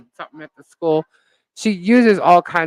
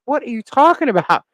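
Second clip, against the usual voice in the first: incredulous; 7 semitones higher, 5 dB louder than usual. Retold or imitated. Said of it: "I'm like".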